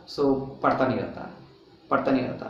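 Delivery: ordinary talk, nothing marked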